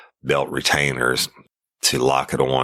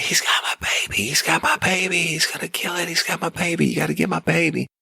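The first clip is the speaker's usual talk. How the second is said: much higher, panicked pleading cry; frantic screaming woman. Retold or imitated. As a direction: imitate a mother begging for her baby. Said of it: "she's screaming frantically".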